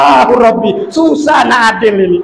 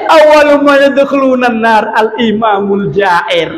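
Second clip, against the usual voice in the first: Arabic hadith recitation. Acted. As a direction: declaim it like scripture. Quoting the Prophet in Arabic.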